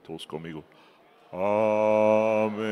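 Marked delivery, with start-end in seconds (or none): 1.3-2.7 lightly sung, lower churchy amen; a hint of a pompous chanted amen; mock pious amen. Preacher mocks a pious congregation's amen, lightly sung lower. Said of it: "hasta cambian su forma de hablar".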